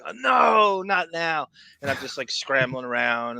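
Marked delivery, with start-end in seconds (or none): 0.1-1.5 higher, drawn-out mock groan of protest; retold dismay. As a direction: protest as if caught at a bad moment. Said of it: "I'm like".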